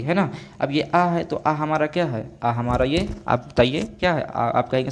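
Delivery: usual speaking voice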